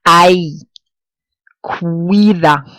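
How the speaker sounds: "Ay", "Cuida", each vowel pair as one sound; vowels glided together. Shown as a demonstration. Teacher showing diphthongs, "Ay" and "Cuida" with joined vowels. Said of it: "two vowels together form a separate sound".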